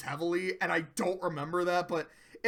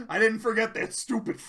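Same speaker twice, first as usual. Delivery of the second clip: usual speaking voice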